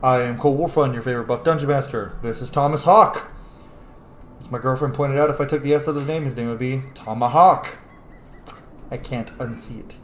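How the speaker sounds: usual speaking voice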